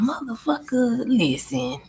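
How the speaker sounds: ordinary delivery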